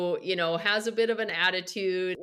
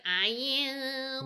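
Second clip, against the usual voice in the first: imitating a whiny singer; nasal whine, drawn out; 4 semitones higher, 3 dB quieter than usual. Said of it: "He has a really whiny voice".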